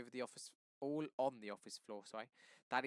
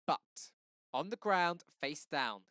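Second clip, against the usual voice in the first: cop yelling orders, much louder; 5 semitones higher, 11 dB louder, slower than usual. Acted. Officer yells at Daddy to lie face down. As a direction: bark the order at the man on the ground. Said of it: "he yells".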